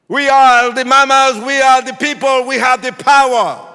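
high, very loud rally cry; political speech shout. Retold or imitated. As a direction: roar it like a rabble-rouser. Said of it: "It was a political speech".

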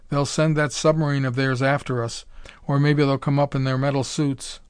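ordinary delivery

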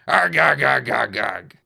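loud, fading pirate arrs; pirate growls. Acted. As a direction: growl arg through pain. Wounded pirate grunts.

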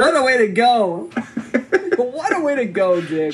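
put-on slow, drawn-out old miner; lightly voiced grizzled prospector. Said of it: "quit talking like an eighteenth century prospector".

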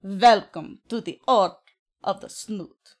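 slow mock Serbian accent; drawn-out, hammed-up Serbian-accented welcome. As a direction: announce it slowly in a put-on Serbian accent. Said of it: "Very nice Serbian accent".

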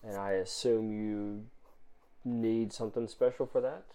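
a guarded stranger weighing her words; quiet suspicious woman; mysterious stranger. Acted. GM voices stranger.

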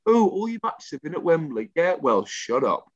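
ordinary delivery

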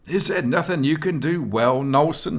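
high, mocking and scornful; scornful jeer. A narrator's reading. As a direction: jeer at him for the crowd. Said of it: "he jeered scornfully".

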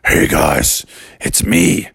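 low-pitched spaceman voice; stranded spaceman. Acted. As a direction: greet the kids deep and low. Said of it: "And he said".

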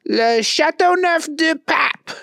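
high, odd put-on voice; squeaky character voice; Keith voice. A narrator's reading. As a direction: talk it up like a know-it-all pal. Keith gives directions.

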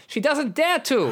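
put-on high, slow accented voice; Nightcrawler. Nightcrawler pities Rogue, high and slow. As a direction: draw it out high, in character. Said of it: "you tell Wolverine".

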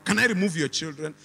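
usual speaking voice

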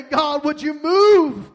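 loud, slow pleading cry. Real, not acted. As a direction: plead at the top of your voice. Crying out in prayer.

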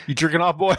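mild mock drill instructor; lightly voiced drill instructor. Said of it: "It's like".

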